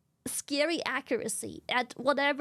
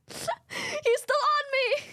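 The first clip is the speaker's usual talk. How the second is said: high, slow, laughing whine; laughing horse voice; 12 semitones higher, slower than usual. Retold or imitated. Horse crying out in the story.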